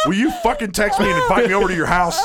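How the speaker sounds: high, loud, laughing demand; mock exasperated outburst. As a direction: yell it in comic exasperation. Acted exasperated plea to a friend.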